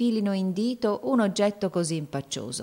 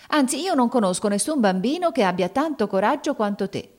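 higher, exasperated insistence; impatient insistence; 6 semitones higher, 3 dB louder than usual. A narrator's reading. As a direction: press the point in mild exasperation. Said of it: "proruppe spazientita".

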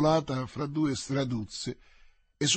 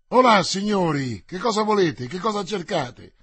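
usual speaking voice

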